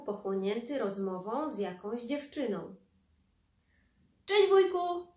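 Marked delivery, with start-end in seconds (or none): 4.3-5.1 much higher, louder greeting; excited shout. Acted. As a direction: call across the park happily. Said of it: "krzyknął Bartuś". (Bartuś radośnie woła wujka z daleka)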